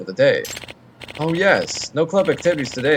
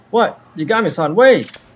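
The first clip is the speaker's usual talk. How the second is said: high, loud cry of alarm; sudden shout calling after a friend; shocked shout; 6 semitones higher, 4 dB louder than usual. Acted. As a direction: yell after him to wait.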